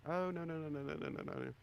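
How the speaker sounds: high-pitched string of hurried denials; hasty protest. Acted. Adventurer to a hungry giant, hasty high protest. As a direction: rattle off the refusals, pitched high.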